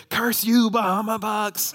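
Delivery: lightly voiced child hissing a curse; child in the back seat, mock curse under breath. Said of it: "yells under their breath".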